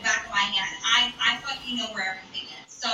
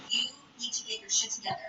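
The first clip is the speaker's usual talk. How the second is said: fast, hushed scolding; whisper; 2 semitones lower, 6 dB quieter, faster than usual. Retold or imitated. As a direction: whisper a quick telling-off. Scolding friend in bathroom.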